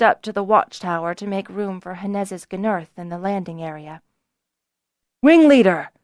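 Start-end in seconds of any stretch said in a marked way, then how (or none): 5.2-5.9 loud, high call after him. A narrator's reading. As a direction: call to stop him before he leaves. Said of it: "Beeman called out".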